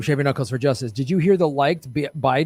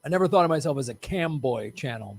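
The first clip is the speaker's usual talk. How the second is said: ordinary delivery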